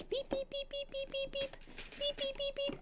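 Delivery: very high, far beep-beep chirps; imitated phone beep.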